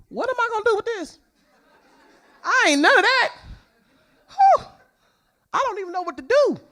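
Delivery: high, fast overwhelmed protest; mock panic. Tomboy mom faced with a girly daughter, mock panic. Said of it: "But, ooh, I'm scared".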